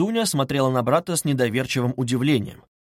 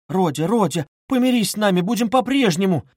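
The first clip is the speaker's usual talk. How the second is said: high, louder entreaty; mother's raised, imploring cry; pleading exclamation; 7 semitones higher, 4 dB louder than usual. A narrator's reading. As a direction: beg him desperately to make up. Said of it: "воскликнула бедная мать".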